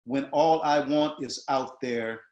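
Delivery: slow, spacing each short word; slowly spaced reading; slow monosyllables. Shown as a demonstration. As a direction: let each word land separately. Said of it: "Now look at this".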